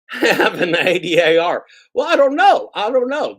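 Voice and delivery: giggling bewilderment; laughing squeak. Real, not acted. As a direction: laugh through it in a squeaky pitch.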